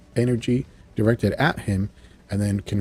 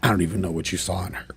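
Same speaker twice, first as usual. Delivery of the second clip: softer, dismissive scoff; scornful; 3 semitones lower, 6 dB quieter, faster than usual. Retold or imitated. Madeline looks down on fainted Jean, scornful. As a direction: toss it off with mild contempt.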